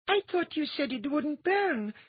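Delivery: very high put-on frightened voice; a scared girl protesting. A narrator's reading. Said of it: "Undine said in a frightened voice".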